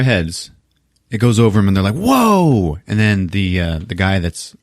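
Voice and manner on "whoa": high, drawn-out awe; mock amazement. Couple watch a meteor go over, mock amazed. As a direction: imitate gawking at something flying over.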